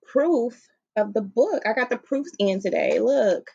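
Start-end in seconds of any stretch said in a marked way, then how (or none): none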